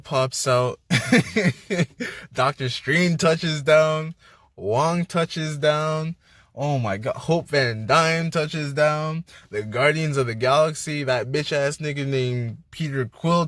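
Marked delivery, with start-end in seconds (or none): none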